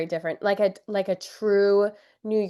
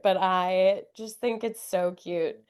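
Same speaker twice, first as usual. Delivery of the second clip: normal talking voice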